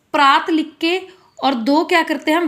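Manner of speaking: ordinary talk, nothing marked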